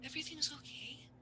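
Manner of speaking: very soft, high whisper; whispered, anxious reassurance; urgent soothing. Acted. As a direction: hush a frightened partner insistently. Amanda soothing frightened Bennett.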